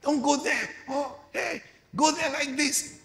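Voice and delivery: preacher voices wisdom crying out at the gate in a high voice. Acted. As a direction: call out high like a woman at the gate. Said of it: "she's crying out".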